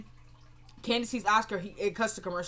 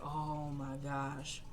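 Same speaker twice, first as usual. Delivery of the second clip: low, soft, drawn-out disbelief; quietly appalled, stretched out and low; acted dismay; 7 semitones lower, 11 dB quieter, much slower than usual. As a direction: let it out slowly under the breath. Acted dismay at mortgage confession.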